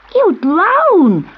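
put-on a girl cries out in alarm; alarmed girl's cry. A narrator's reading. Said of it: "cried one of the girls".

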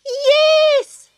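very high, loud drawn squawk; chuckling kookaburra. A narrator's reading. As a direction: squawk it with gleeful amusement. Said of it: "chuckled the kookaburra".

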